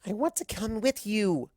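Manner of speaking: put-on high young filly's plea; pleading filly. Daughter asking to go with her father, pleading. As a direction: ask to come along, eager.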